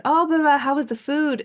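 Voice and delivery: high, loud chirpy greeting; eager question. Retold or imitated. Friend greeting her back from vacation, chirpy and high. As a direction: bounce up in excitement to ask.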